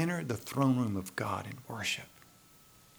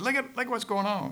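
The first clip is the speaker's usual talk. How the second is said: high-pitched mock whining complaint; griping moan; 12 semitones higher than usual. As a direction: moan and groan in a much higher voice. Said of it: "he's moaning and groaning and griping".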